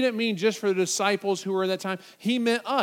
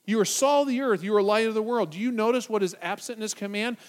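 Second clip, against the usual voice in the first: ordinary talk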